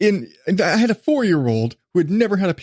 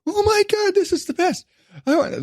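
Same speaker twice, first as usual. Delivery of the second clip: put-on much higher, gushing kid voice; high childlike delight; excited little boy. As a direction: squeal it like a thrilled preschooler. Son tastes the jelly.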